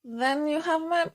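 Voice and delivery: drawn-out, nasal attempt at a masked villain; Bane impression. Shown as a demonstration. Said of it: "to do my Bane impression".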